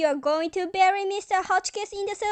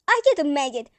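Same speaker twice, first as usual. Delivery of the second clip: ordinary talk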